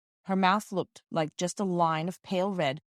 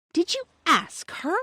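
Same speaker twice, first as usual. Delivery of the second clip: high, slow scornful question; 12 semitones higher, 5 dB louder, slower than usual. A narrator's reading. Roberta scorning Peter for asking the servant, high and slow. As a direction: sneer it at your brother, drawn out. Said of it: "said Roberta with scorn".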